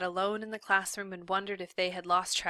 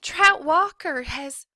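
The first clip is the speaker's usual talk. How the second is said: urgent; 9 semitones higher, 11 dB louder, slower than usual. Acted. Catherine urgent, cut off.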